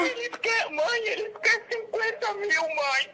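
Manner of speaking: high, pleading fake-scared daughter; mock panicked pleading. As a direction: cry out to mom like a hostage. Fake hostage relays ransom demand.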